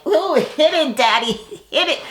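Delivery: high hammed-up bedroom voice; mock moaning. Vlogger mocking Portia in the room, hammed-up moan. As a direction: ham up her moaning. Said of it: "and you were like".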